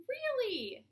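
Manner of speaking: high, loud mock-surprised really; surprised echo. A narrator's reading. Teacher teasingly echoes BB's eager question.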